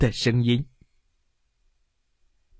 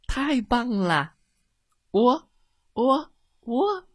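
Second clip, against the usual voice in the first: much higher, delighted cheer then a crow; joyful crowing; 10 semitones higher than usual. Acted. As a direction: burst out crowing with joy. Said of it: "他转着圈儿欢唱着". (高兴地欢叫打鸣)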